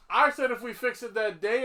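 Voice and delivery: ordinary talk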